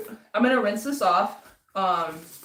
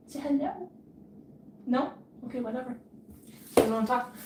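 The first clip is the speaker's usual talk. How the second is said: talking to a pet; 5 semitones higher, 4 dB quieter than usual. Real, not acted. Baby talk to cat.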